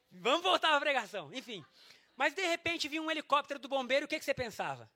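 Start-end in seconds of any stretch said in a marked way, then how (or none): none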